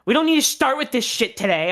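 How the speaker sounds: loud, high mock rebuke; retold manager's scolding. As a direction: imitate an irritated manager telling someone off.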